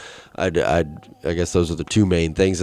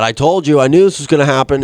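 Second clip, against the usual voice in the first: higher, louder, fast told-you-so; mock told-you-so; 6 semitones higher, 5 dB louder, faster than usual. Boy scolding the friends who flipped the cart, louder. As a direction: scold them like he saw it coming. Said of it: "And I said".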